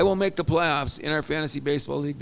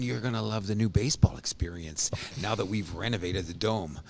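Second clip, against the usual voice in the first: low, nerdy executive impression; Atkins Shapiro voice; 9 semitones lower, 4 dB quieter than usual. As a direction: talk like a nerdy front-office exec, lower. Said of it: "I love your Atkins Shapiro voice".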